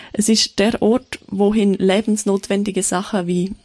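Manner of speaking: ordinary talk, nothing marked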